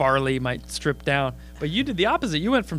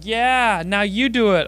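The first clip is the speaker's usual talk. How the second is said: higher, louder gloating dare; triumphant heckler; 5 semitones higher, 7 dB louder, slower than usual. Retold or imitated. Topless girl daring the comic, gloating. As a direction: throw it back at him. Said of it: "she's like".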